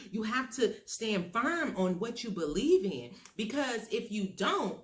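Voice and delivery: ordinary talk, nothing marked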